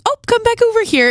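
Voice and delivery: imitating a patient mom, high-pitched and light. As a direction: gently call the kids back in a high voice. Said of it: "she did not yell at them".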